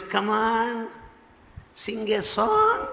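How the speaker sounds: high, slow sing-out invitation; imitating Swami's urging. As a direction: call her on, stretching the last word high. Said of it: "Swami says".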